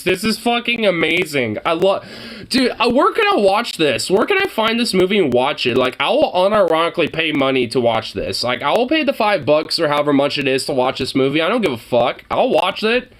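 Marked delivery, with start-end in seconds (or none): none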